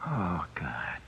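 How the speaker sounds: breathless, voiceless gasp of dread; the news knocks the air out of him; horrified whisper. Acted. Condemned man whispers to Skeeter in dread. Learns execution is tomorrow.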